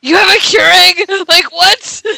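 can't believe what you just heard; incredulous. Retold or imitated. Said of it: "I was just like".

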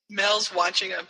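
plain reading voice

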